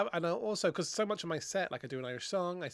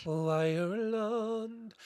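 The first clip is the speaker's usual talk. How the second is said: Oh, Ireland drawn out in a slow, heavy Irish accent; exaggerated Irish; 5 semitones higher, much slower than usual. Shown as a demonstration. Comedian hamming up the Irish accent the host noticed. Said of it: "you went very Irish when you said Ireland".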